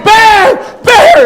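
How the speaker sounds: high, loud frightened shout; panicked yelling. Retold or imitated. Old man with walker fleeing the bear, panicked shout. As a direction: yell it while fleeing a bear.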